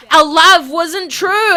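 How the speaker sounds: loud, high, drawn-out protest; anguished accusation. Acted. Whitney rejecting Stephanie after the killing, anguished. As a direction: cry it out slowly, hammed-up.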